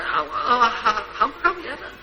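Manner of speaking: soft, laughing mock shrug; a quiet, laughing imitation of an indifferent monk; mocking dismissal. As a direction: brush it off as if it meant nothing. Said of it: "mà ông nói".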